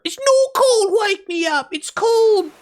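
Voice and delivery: very high shrill-free squeaky outraged friend voice; Lit's conniption fit. Retold or imitated. Streamer mocks Lit's outrage over the song title, very high. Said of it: "I'll have the conniption fit for her".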